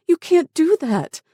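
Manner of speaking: very high, loud protest; horrified outburst, voice leaping up; desperate cry. A narrator's reading. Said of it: "Tara cried".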